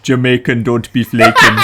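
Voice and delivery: low-pitched put-on Jamaican accent. Shown as a demonstration. Friend performing the catchphrase on a dare, mock Jamaican accent. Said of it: "in a Jamaican accent right now".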